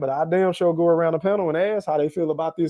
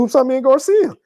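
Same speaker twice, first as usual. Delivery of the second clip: usual speaking voice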